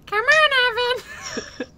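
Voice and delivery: high, slow squeaky Elmo voice. Retold or imitated. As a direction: do your best Elmo. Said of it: "my Elmo impression".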